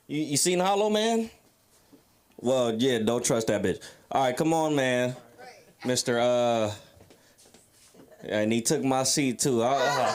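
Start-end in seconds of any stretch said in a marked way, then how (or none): none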